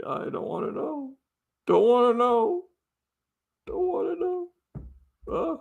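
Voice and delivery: very high little put-on voice; pretend assistant reply. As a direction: squeak out a mock refusal.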